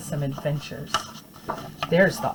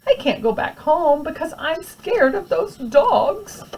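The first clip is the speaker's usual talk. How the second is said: put-on scared child; 11 semitones higher, 4 dB louder, slower than usual. Scared boy's thought.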